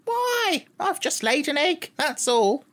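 high, crisp, matter-of-fact hen; a prim little hen, quite unbothered; small sharp hen voice. A narrator's reading. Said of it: "replied a small but sharp and distinct voice".

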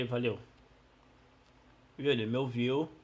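usual speaking voice

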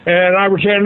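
ordinary talk, nothing marked

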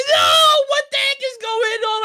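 loud high wail, easing off after; panicked cry. Acted. Player is lifted away from his stuff, panicked cry. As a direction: burst out high in dismay.

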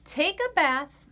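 ordinary delivery, nothing marked

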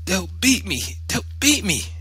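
very high, repeated wail; panicked boy. A narrator's reading. Boy begging mother not to send him, high panicked wail. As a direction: plead frantically in a child's high voice.

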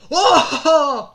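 high loud "Ω!" of shock; surprised yelp. Real, not acted. Excited yelp during play. (ξαφνιασμένη δυνατή κραυγή)

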